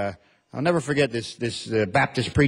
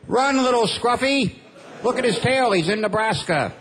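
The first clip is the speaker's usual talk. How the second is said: calling after the dog; 9 semitones higher than usual. Pretend. Owner on the porch calling after his runaway dog, mock fond call. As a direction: cheer the dog on from the porch, hammed up.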